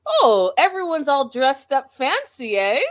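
loud, high-pitched mock greeting; hammed-up Boston loudmouth making an entrance; playful ribbing in a put-on voice; imitating Boston guy. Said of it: "he's just in his Boston gear".

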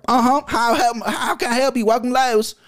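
put-on sing-song country girl answering the store phone; country lady. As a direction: play the chirpy Lowe's phone lady from the country. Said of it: "This country lady, country girl".

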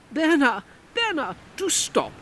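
high imperious lady's voice; commanding Comtesse. Acted. Comtesse instructing Bernard to stop, imperious. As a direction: order him to stop from above. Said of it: "instructed the Comtesse".

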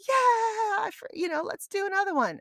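high, teary, giddy voice; giddy elation. Retold or imitated. Runner just past the finish line, high and giddy. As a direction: bubble over with relief. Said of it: "it was almost like".

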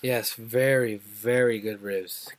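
slow, low, lip-smacking praise; savoring. Real, not acted. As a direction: drag it out like tasting the ribs. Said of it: "He's smacking his lips".

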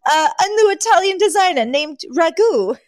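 high put-on voice bluffing the press; Fran. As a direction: pitch it way up, playing the fashion celebrity. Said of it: "And she goes".

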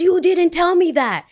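high, loud indignant customer imitation; mock outrage. Customer blaming the barista, loud mock outrage. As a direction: protest loudly as the wronged customer.

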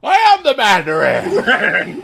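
high, slow, laughing mock yell; hammed-up villain cry. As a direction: howl it through laughter like a drowning villain. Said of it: "and he's like".

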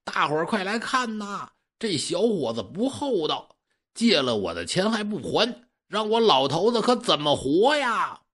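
hammed-up wail to draw a crowd; calling out to the crowd. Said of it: "这刘老三可就喊上了". (老头儿扯着嗓子向大伙儿喊冤)

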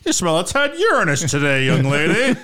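high, hammed-up period character; mock-proper complaint, pitched well up; mock old-time gentleman. Acted old-time suitor scolding lady.

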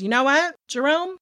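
lilting mock-generous pronouncement; baller interrogator. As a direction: play a cool investigator letting someone off. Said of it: "baller ass Joe goes".

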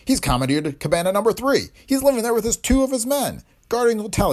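high, loud outraged ranting. A narrator's reading. Hotel owner ranting to the district attorney, high outraged voice. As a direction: sputter with outrage at the freeloader. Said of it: "Blount continued in outraged accents".

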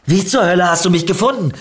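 schnell, laut und hoch herausgeschrien; wütendes Anschreien. A narrator's reading. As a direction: sie zornig zur Rede stellen. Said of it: "schrie Niki sie an". (wütend angeschrien, in hohem Tempo)

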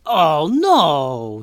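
loud, high dismayed inventor; dismayed scientist. A narrator's reading. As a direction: cry out over the broken robot.

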